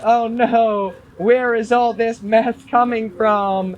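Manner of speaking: hammed-up innocent puzzlement; mock helplessness. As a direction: play clueless officials wailing in fake dismay. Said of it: "The D E A is like".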